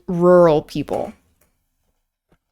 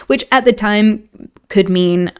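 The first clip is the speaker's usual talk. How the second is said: usual speaking voice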